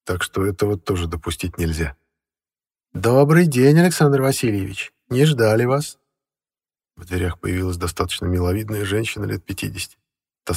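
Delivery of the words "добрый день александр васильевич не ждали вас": housekeeper voice. A narrator's reading. Housekeeper greets.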